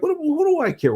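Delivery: high-pitched dismissive imitation; not caring. Wife unimpressed by a famous artist, dismissive. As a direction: toss it off high, mock scornful. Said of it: "she didn't care".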